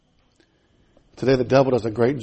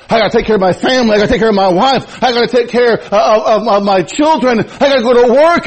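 pleading excuse-maker; 8 semitones higher, 6 dB louder than usual. Acted. Preacher voicing a congregant's excuses.

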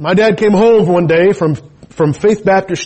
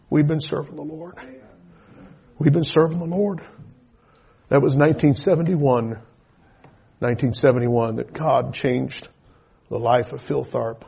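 ordinary talk

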